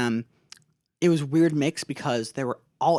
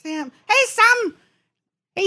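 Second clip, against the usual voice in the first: much higher, louder call; calling Sam; 8 dB louder than usual. Acted. Frodo calling out to Sam, loud and high. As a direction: shout Sam's name from afar.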